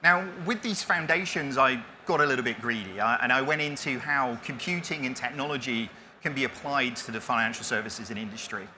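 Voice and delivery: ordinary delivery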